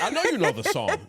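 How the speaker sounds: high, loud laughing tease. Retold or imitated. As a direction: laugh through the quote at him. Retelling teasing friend, laughing.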